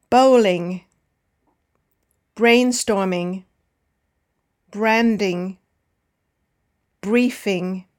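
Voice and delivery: first syllable stressed in "Bowling", "branding", "coaching", falling away after. Shown as a demonstration. Teacher reads words for learners to repeat, first syllable stressed as in BRAND-ing. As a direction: model each word slowly for repeating, stressing the start: BOWL-ing, BRAIN-storming. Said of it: "All have the stress on the first syllable".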